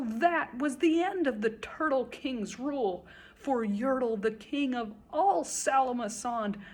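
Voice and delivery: ordinary delivery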